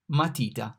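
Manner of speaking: matita, clear Italian vowels; Italian word. Shown as a demonstration. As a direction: model matita for learners. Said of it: "The M here is pronounced".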